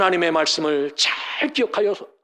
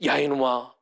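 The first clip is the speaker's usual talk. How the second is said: scornful taunt; 8 semitones lower, 4 dB quieter than usual. Retold or imitated. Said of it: "이렇게 조롱했다는 겁니다".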